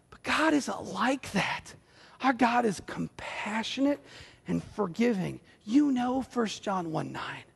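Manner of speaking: ordinary delivery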